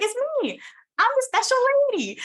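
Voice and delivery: high, loud thrilled squeal; excited squeal. Retold or imitated. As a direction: squeal it like you just got picked. Realizing song dedicated to her.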